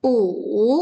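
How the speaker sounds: u dipped and swung; falling-rising third tone. Shown as a demonstration. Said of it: "ada tone-nya, nada tiga".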